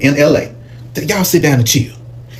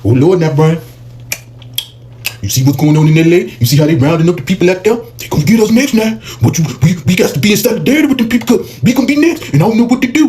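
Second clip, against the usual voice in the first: mock scared man; 2 semitones higher than usual. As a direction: ham up a scared worrier. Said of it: "Talking that scared talk".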